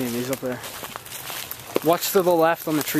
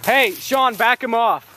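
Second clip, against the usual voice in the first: shouting orders over battle; 8 semitones higher, slower than usual. Real, not acted. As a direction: yell the orders across the field. Calling to teammate under fire.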